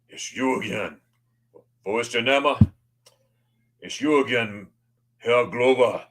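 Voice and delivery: lightly imitated German ticket lady; mock-serious conductor recognizing a regular; serious ticket inspector. Ticket lady spots her regular passenger, mock serious. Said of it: "she's all serious".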